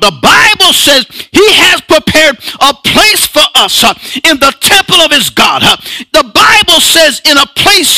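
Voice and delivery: high, rhythmic pulpit cry with punched "huh" breaths; full-force preaching. Acted. Preacher rousing congregation about their new name, full-force whooping.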